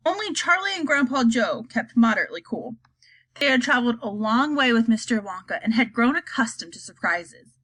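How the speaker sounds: plain reading voice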